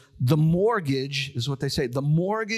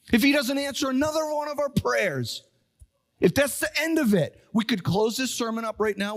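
fervent preaching; 6 semitones higher than usual. Acted. Fervent sermon appeal.

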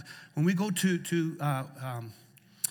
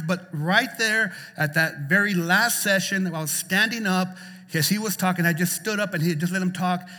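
loud, raised-pitch preacher delivery; impassioned preaching; 4 semitones higher, 6 dB louder than usual. Acted. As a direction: preach it out with urgent force. Preaches at full force.

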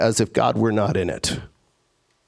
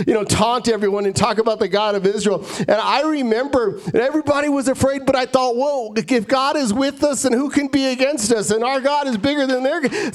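higher-pitched, breathless-sounding run-on testimony; gushing, rambling David; 5 semitones higher than usual. Acted. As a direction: gush on and on, building higher with each memory. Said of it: "David would just go on and on".

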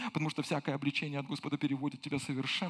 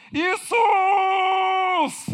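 high, loud drawn-out shout of the name; crying out; 13 semitones higher, 10 dB louder than usual. Acted. Blind man crying out again.

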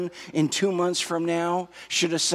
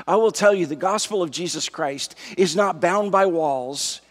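raised, emphatic pulpit voice; fervent sermon delivery, pitched up and punchy; impassioned preaching; 4 semitones higher, 4 dB louder than usual. Real, not acted. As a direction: preach it with mounting conviction, stretching key words. Preacher builds to full-force exhortation.

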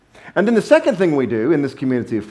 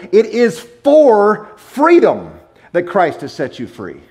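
It is for freedom slow and loud, then the rest drops back; quoting Paul; 5 semitones higher, 4 dB louder, slower than usual. Shown as a demonstration. Preacher quoting Paul to the congregation, slow and loud. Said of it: "and I quote".

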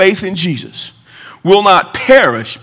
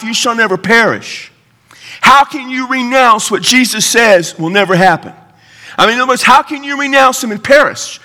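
impassioned preaching, pitched up; żarliwe kazanie; 6 semitones higher than usual. Real, not acted. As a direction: press the argument on the congregation. Preacher presses the argument.

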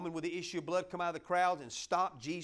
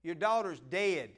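loud, higher tactless buddy breaking the news; blunt friend; 3 semitones higher, 6 dB louder than usual. Retold or imitated. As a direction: blurt the bad news like a careless bro. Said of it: "Jairus' friends".

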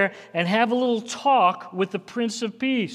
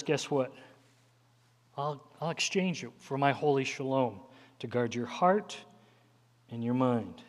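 softer, gentle and hushed, full of peace; soft voice of the Lord; 6 semitones lower, 9 dB quieter than usual. Retold or imitated. The Lord softly reassures the anxious believer, gentle and hushed. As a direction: hush it down like a comforting whisper of grace.

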